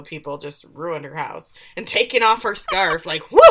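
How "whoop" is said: quick, loud whoop; playful whoop. Retold or imitated. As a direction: punch out a sudden whoop. Imitates scarf being whipped off.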